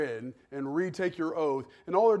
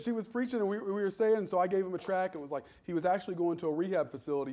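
usual speaking voice

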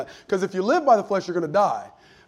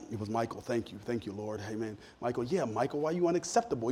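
muttered relieved aside, softer; under the breath; 7 semitones lower, 10 dB quieter than usual. Retold or imitated. Staff muttering about a colleague, softly under the breath. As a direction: mutter it beneath your breath, half teasing. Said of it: "beneath your breath".

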